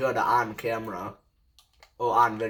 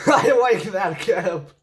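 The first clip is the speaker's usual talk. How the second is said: laughing; 7 semitones higher, 8 dB louder, slower than usual. Real, not acted. Mocking an opponent's cap.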